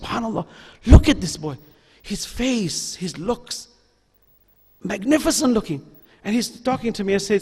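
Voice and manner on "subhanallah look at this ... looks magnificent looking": much softer, marvelling under the breath; hushed admiration. Retold or imitated. Imam marvelling at a handsome boy, hushed. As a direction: murmur in quiet wonder.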